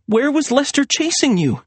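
very high, upset voice; confused question. A narrator's reading. Serena questioning Maria about the chase, confused. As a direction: question her in bewilderment.

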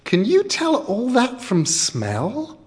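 high, slow wonder; a child in awe; amazed child. Acted.

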